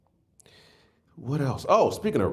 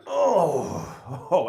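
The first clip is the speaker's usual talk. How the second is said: groan getting up; 7 semitones higher, 4 dB louder than usual. Retold or imitated. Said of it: "I stand up, I'm like".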